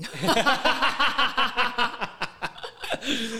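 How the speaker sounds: loud, high peal of laughter; burst of laughter. Real, not acted. Laughing at one's own joke to the co-host, loud laughter. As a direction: crack up in a long laugh.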